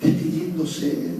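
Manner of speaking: normal talking voice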